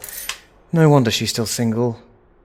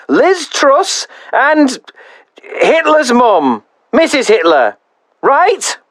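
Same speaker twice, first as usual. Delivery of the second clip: high, outraged middle-aged complainer; indignant caller; 12 semitones higher than usual. Acted. Mother on the answerphone berating the host, high and outraged. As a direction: huff and puff in a high, offended voice.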